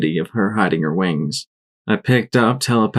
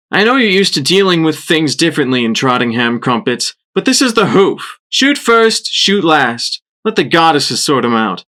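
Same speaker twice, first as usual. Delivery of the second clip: higher, louder swaggering voice; brash ranger mare; 6 semitones higher, 3 dB louder than usual. A narrator's reading. Shrapnel lecturing Crumpets about shooting first. Ranger boasting to her partner.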